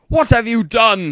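high, loud anguished demand; crying out. A narrator's reading. Dumbledore walking in on Ebony, crying out. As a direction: cry it out in loud dismay. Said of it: "he started to cry wisely".

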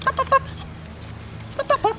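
excited coaxing. Real, not acted. Coaxing the puppy up.

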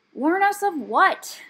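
high, loud, drawn-out demand; loud, fed-up question; exasperated. A narrator's reading. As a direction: demand impatiently, stretching the words. Brownie snaps in exasperation.

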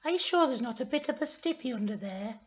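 high falsetto character; coaxing question in a high voice; put-on girly falsetto; Florence. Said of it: "said Florence".

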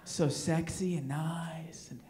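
slow dreamy whisper; mock swoony whisper; swooning whisper. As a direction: breathe it out like a smitten fan. Breathy swooning whisper of women.

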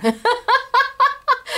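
sudden high giggle; bright laugh. Real, not acted. Host laughing about sitting on a throne, giggling. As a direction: burst out laughing at the idea.

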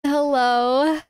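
usual speaking voice

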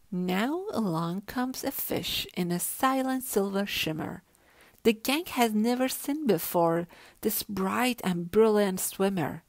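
ordinary delivery

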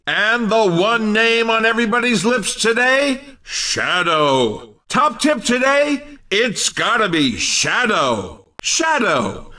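ordinary talk, nothing marked

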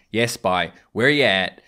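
normal talking voice

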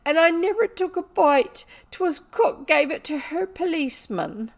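put-on high, loud wailing servant girl; acted tearful indignation; crying. A narrator's reading. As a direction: sob out your grievance. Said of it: "cry into her pillow".

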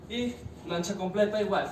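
ordinary talk, nothing marked

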